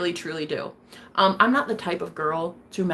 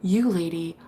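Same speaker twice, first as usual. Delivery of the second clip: ordinary talk, nothing marked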